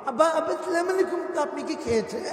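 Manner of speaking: high, affected, sugary mock greeting; fawning son-in-law. Son-in-law asks after his wife's father, fawning affected voice. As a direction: simper the polite questions in a put-on high voice. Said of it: "এই বাপ রে বাপ!".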